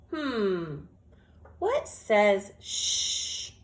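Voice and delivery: ordinary delivery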